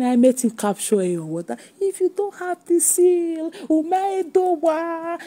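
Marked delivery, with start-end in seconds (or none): 1.8-5.2 high, drawn-out mock warning; mocking imitation. Mimicking the woman's talk about the seal, mocking imitation. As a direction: stretch it out high, making fun of her.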